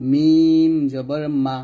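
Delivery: মিম drawn out, pressed from the dry part of both lips; letter articulation demo. Shown as a demonstration. Teacher showing students lip letters, মিম long and closed-lipped. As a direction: hum a long মিম, then a short মা, lips meeting dry. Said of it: "দুই ঠোঁটের শুকনা অংশ থেকে".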